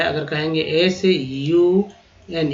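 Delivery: ordinary talk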